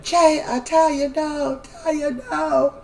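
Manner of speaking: high, slow frantic yell; high drawn-out mock scream; retold screaming. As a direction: shout it slowly, desperate. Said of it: "Jada's just screaming".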